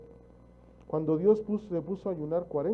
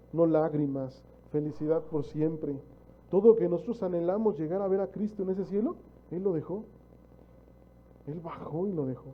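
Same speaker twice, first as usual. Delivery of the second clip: ordinary talk, nothing marked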